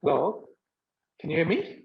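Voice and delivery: normal talking voice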